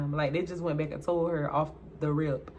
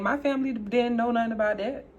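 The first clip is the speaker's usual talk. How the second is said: usual speaking voice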